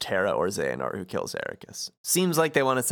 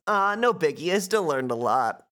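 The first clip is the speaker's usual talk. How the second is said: higher, lively character voice; Sora; 6 semitones higher than usual. Acted. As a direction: play the breezy young hero, pitched up. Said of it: "Sora says".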